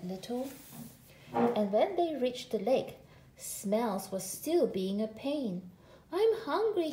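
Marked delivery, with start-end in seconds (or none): 6.1-6.9 high, whiny plea from a small cub; whining. A narrator's reading. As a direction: whine it like a tired little brother. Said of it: "he whined".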